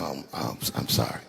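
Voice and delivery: backpedal, quiet and embarrassed; low sheepish mumble; sheepish apology. Retold or imitated. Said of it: "like, dumb".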